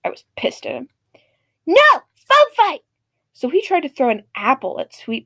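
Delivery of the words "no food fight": put-on kid yelling. Kid repeats food fight.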